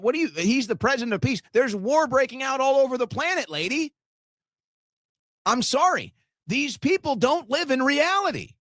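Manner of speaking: high-pitched, exasperated disbelief; incredulous. Real, not acted. Streamer answering a woman's claim about war and peace, incredulous. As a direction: throw it back in outraged disbelief.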